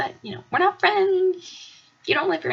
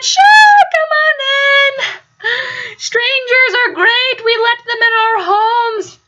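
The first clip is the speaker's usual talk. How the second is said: much higher, louder mock-cheerful welcome; sarcastic welcome; 8 semitones higher, 4 dB louder, slower than usual. Letting strange girls into the apartment, mock-cheerful and much louder. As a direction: sell the welcome with an ironic grin. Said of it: "And I said".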